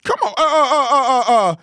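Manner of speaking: high, loud stunned laughter; incredulous laugh that breaks into stammers; laughing in disbelief. Real, not acted. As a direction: crack up at the clip, unable to believe it.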